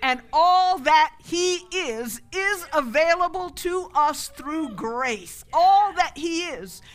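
loud, high, slow proclamation; full-force preaching. Real, not acted. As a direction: drive each phrase home to the congregation.

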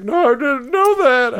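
alarmed surprise. Retold or imitated. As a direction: sound caught out and alarmed. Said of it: "She's like".

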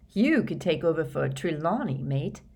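slightly odd, uneasy voice; a touch strange, not quite natural. A narrator's reading. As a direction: say it in a mildly odd voice. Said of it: "he said in an odd voice".

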